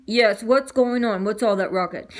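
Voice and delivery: a gruff-lite father; hint of a dad.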